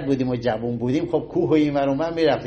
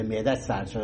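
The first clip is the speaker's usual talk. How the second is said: same saying repeated, dropped much softer; softer echo of saying; 6 semitones lower, 7 dB quieter than usual. Retold or imitated. Lecturer echoing the folk saying to listeners, now much softer. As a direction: let the repeat sink away quietly. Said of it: "همه جا شنیدی میگه".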